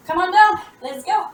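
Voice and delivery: loud upbeat call; rally the class down. Real, not acted. Coach calling class down.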